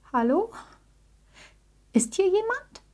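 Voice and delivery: high, questioning call into the unknown; calling out timidly. A narrator's reading. Frightened little bear calling into the dark, timid call. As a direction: call out, rising high at the end. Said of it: "rief Jackie in die Dunkelheit".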